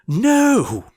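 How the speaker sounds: very high, loud scream of horror. A narrator's reading. Prince screaming at the ugly sister. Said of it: "The prince screamed".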